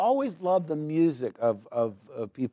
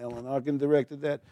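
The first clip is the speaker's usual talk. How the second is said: normal talking voice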